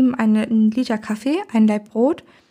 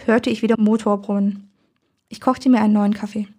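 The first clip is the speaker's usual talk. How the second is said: usual speaking voice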